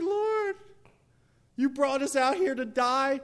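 anguished, high loud cry to heaven; Joshua. Acted. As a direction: wail it in hammed-up despair. Joshua tears clothes, crying to God.

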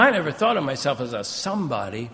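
ordinary talk, nothing marked

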